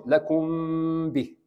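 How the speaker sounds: لكم به with a long held nasal m before باء; ikhfa with ghunna. Shown as a demonstration. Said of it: "Just touch it". (لكم به بإخفاء وغنة ممدودة)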